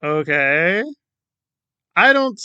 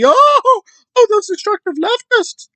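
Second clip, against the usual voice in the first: very high, louder, pearl-clutching mock voice; mocking Kelly; 5 dB louder than usual. Commentator parodying Kelly's anti-left video, high pearl-clutching mock. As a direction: clutch your pearls in a falsetto. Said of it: "Went full Joe McCarthy".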